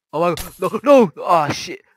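panicked yelling as creepers explode; terrified scream. Real, not acted. Gamer screams at creepers by his house, terrified. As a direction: shriek it in real fright. I got scared and pressed E.

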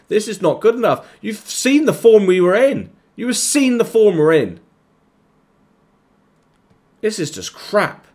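high, loud shouting; furious rant. Real, not acted. Gamer raging at his team losing at home, furious shout. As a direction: rage at the team. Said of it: "I'm just getting annoyed now".

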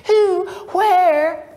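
high, eager and excited. A narrator's reading. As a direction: jump in with breathless eagerness. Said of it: "Gold asked more eagerly".

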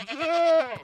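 a sudden high scream of real fright; startled yelp. Real, not acted. Player jumped by a goat, high startled yelp. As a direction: jump out of your skin and yelp. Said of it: "that actually scared me".

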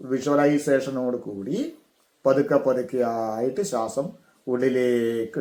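slightly hushed, stretched-out vowels; guided meditation. Shown as a demonstration. Teacher guiding breath down through the body, guided meditation. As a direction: draw the words out like a long inhale.

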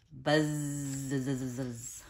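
drawn-out low buzzing bzzz; bee buzz. Retold or imitated. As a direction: buzz it like a bumblebee. Imitating a bumblebee.

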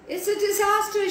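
high, fast panicky report; alarmed. Acted. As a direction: blurt out the alarm. Tabitha raises the alarm.

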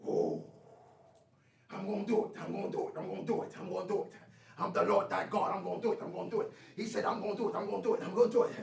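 deep, low chanting promise; a low, steady divine vow, repeated; low voice of God. Acted.

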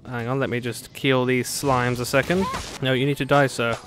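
ordinary talk

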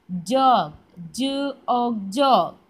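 whole ജോഗ്, then ജു, ഓഗ്, and loud ജോഗ്; sounding out. Shown as a demonstration. Teacher giving children the next word, sounding out ജോഗ്. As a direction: say ജോഗ്, break it into sounds, blend it back. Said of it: "sound പറഞ്ഞ് പഠിക്കുന്നതാണ്".

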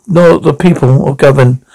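ordinary talk

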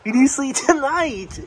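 high, insistent plea; high-pitched scolding of a sleepy parent; nagging. Real, not acted. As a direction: pester dad in a high voice. Nagging dad who keeps dozing.

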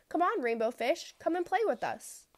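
high, bright call to play; calling out playfully. Acted. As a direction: call him over to play. Fish friends calling him over.

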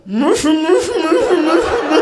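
high mock crying through a muzzle; comic high-pitched muffled whimpering; muffled cries. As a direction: imitate a gagged child's repeated wails. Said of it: "He's muffling his own cries".

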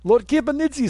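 put-on Kipanidze said high in a thick Russian accent. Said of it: "my Russian accent's dying".

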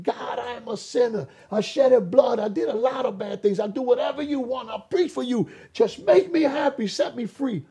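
high, insistent cry to God; pleading prayer. Retold or imitated. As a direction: plead upward, pitch climbing line by line.

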